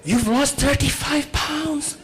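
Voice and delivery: lightly voiced admiring model, stretched out slowly; slow, high flattering model voice; imitating the model. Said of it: "And she tells me".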